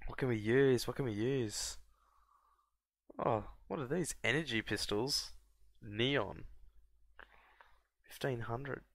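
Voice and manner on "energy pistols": normal talking voice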